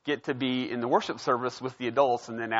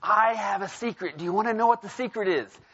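put-on higher, playful children's teacher voice; lightly voiced children's pastor asking a question; teacher teasing kids; 6 semitones higher than usual. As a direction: tease the class with a secret. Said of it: "she starts off by saying".

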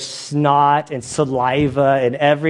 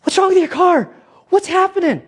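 much higher, louder, alarmed; wife Anna in the car, high and alarmed; imitating his wife; 3 dB louder than usual. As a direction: voice her panic in the car. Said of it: "Anna's going".

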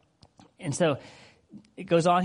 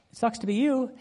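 mock unsympathetic husband; 8 semitones higher than usual.

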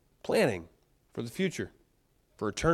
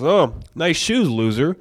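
mock taunt; 2 semitones higher, 8 dB louder than usual. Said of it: "turns to the other guy behind him and goes".

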